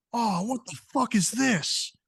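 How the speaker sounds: high, frantic yelp of a guard whose boot is on fire; shrieking guard stamping at a burning boot; panicked guard. Acted.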